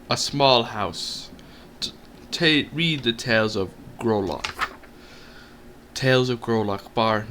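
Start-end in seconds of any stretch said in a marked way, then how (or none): none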